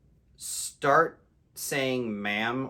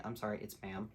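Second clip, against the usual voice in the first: casual, polite; 9 dB quieter than usual. Retold or imitated. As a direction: politely correct them, softly and casually. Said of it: "very casually and politely say".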